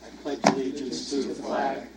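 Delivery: group recitation. Real, not acted. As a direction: pledge recited in unison.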